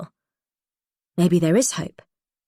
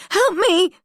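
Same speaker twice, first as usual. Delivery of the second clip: high, loud scream for help; louder terrified scream; 14 semitones higher, 5 dB louder than usual. A narrator's reading. Prisoner screams again as Holly approaches, panicked. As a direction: yell it again, more desperate. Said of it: "I scream".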